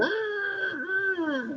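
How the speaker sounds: high, thin imitated shriek of frightened people; mock scream. Preacher imitating people screaming at him. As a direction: squeal like scared jeepney passengers.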